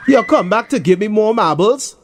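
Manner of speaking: high, cocky question; lightly voiced smug bully; confident grin. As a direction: tease with a grin in the voice. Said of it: "with a confident grin".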